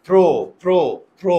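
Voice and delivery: প্র said three times with the jaw kept shut; teacher showing the closed jaw on প্র; closed-jaw demo. Shown as a demonstration. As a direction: repeat প্র with a closed mouth, as pro. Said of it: "মুখের চোয়াল খুলে না".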